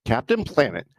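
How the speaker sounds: ordinary talk, nothing marked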